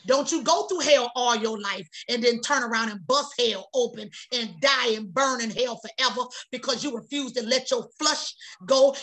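normal talking voice

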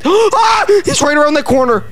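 high-pitched startled scream; scared horror game streamer. Real, not acted. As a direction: gasp and yelp in fright. Jump scare gasp.

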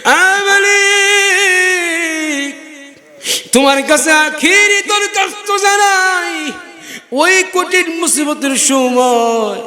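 high, wailing invocation; crying out in prayer. Real, not acted. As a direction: call to God high, then come down pleading. Cries out final plea to God. (উঁচু সুরে আকুল ফরিয়াদ)

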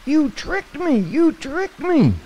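very high, slow, frightened cry of betrayal; soaked mouse accusing the frog, very high and frightened; scared, accusing mouse. Acted. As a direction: shriek it like a tiny wet mouse who feels fooled. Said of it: "he was scared".

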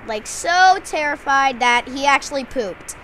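usual speaking voice

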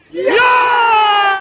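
loud, high cheer; a big goal celebration; celebrating. Real, not acted.

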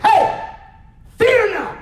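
a high, loud, commanding call of Hey; forceful shout. Retold or imitated. Preacher voicing Jesus telling Jairus not to fear, loud high shout.

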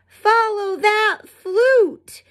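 high, slow chasing call; group yell. Acted. Fifi and Frog call after the flute, high and slow. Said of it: "yelled Fifi, Frog, and Fawn".

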